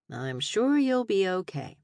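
slow, dry, falling on sure; deadpan it, leaning on sure. Acted. Dry reply to mock grief.

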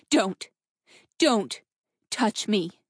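firm, halting warning. A narrator's reading. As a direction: warn him off, pausing between words. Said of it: "she warned".

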